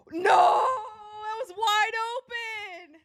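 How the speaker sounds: high, loud, drawn-out cry of disbelief; disbelieving shout. Real, not acted. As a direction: yell it at the screen as the play falls apart. Stunned by a missed wide-open shot.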